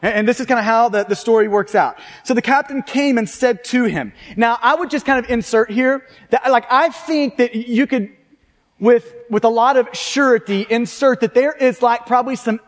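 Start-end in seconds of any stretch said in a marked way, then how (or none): none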